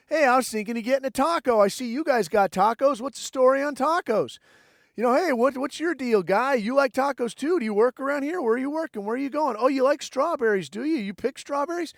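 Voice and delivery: overly casual small-talk voice; mock friendly nosy stranger. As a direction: play dumb and friendly to snoop. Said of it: "and you're like".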